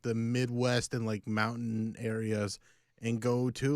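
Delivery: usual speaking voice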